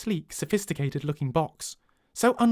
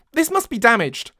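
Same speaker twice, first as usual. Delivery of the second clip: louder, higher frustrated outburst; 8 semitones higher, 6 dB louder than usual. Acted. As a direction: let the frustration out at the machine. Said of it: "I pound the table in frustration".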